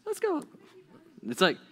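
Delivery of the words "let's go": very high falsetto cartoon squeak; Mickey Mouse. Moses eagerly agreeing to go, cartoon falsetto. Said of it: "sounded like Mickey Mouse".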